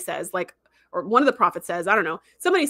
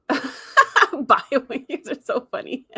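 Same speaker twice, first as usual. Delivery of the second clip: laughing while talking; 4 semitones higher, much slower than usual. Real, not acted. Laughing while reading chat.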